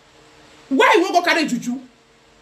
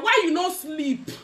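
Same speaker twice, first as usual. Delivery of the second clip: ordinary delivery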